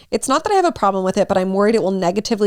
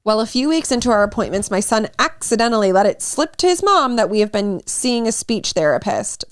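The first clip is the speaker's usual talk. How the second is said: ordinary delivery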